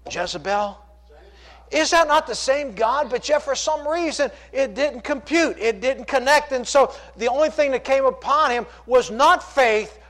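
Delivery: fervent preaching. Acted. Preacher challenging the congregation about Elijah's fear, fervent. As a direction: drive it home with rising fervor.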